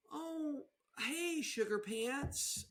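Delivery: high, slow, sugary pet-name coo; mock-sweet greeting. Joker pretends to greet a partner sweetly, mock-sweet. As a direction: softly draw out the cutesy endearment.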